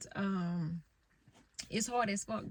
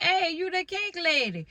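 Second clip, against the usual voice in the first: imitating New Orleans caller; 9 semitones higher, 7 dB louder than usual. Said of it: "He was like".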